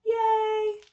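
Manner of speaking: ordinary talk, nothing marked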